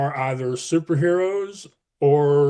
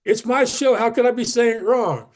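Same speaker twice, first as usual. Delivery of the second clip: higher, louder incredulous protest; indignant disbelief; 8 semitones higher, 4 dB louder than usual. Retold or imitated. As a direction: push back in mock outrage. Indignant retort to podcast interviewer.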